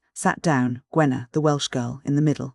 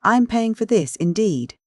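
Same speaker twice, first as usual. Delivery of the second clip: put-on light Welsh accent; 7 semitones higher than usual. A narrator's reading. Gwenna insisting to her friends she'll pay, light Welsh lilt. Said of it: "had a trace of Welsh accent".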